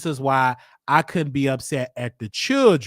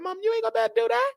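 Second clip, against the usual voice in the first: much higher, very fast; rushed blunt warning; much faster than usual. Retold or imitated. Telling mom she can't do real estate, rushed and high.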